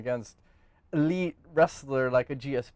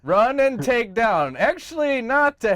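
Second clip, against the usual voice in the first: excited; 9 semitones higher, 7 dB louder than usual. Acted. Joking fighter strategy.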